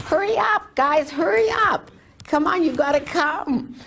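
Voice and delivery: high-pitched, hurrying shout to companions; eager, high-voiced "come on" to stragglers; urgent calling out. Urging companions up the mountain.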